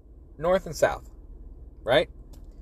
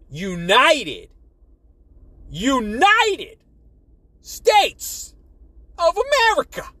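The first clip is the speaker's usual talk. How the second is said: each word pushed higher and louder; emphatic build; 6 semitones higher, 3 dB louder, much slower than usual. Acted. Ranting to viewers about the nation, building emphasis.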